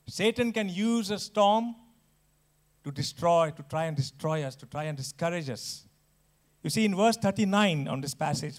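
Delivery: ordinary talk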